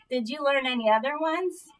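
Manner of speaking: usual speaking voice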